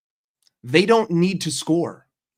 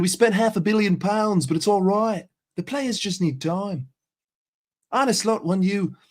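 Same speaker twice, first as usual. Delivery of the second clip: a higher, hammed-up Aussie accent, mate; mock Aussie accent; 4 semitones higher than usual. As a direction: play the chummy Aussie bloke telling fans to be patient. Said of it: "there's the fucking Aussie".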